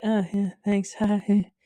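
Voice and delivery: imitating an awkward passer-by, with a nervous laugh; mock uneasy thanks, avoiding contact; awkward little laugh through a mocked hurried greeting; socially awkward mumbler. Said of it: "people don't wanna, like, look you in the eye".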